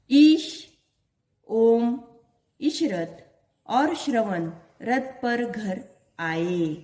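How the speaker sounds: each word spaced out slowly for the class; slow reading aloud. Shown as a demonstration. Teacher reading a sentence slowly to her pupils. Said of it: "वाक्यों का वाचन मैं करने वाली हूँ".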